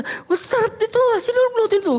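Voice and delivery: high, delighted diner voice; mock delighted churchgoer praising a dish. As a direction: gush over the food in a high voice.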